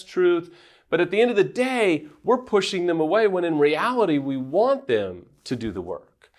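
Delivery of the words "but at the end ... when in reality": normal talking voice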